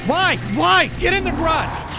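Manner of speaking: high, loud frantic shouting; panicked yell. Real, not acted. Gamer yells at teammates to get into the garage, panicked. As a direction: scream at them to hurry inside.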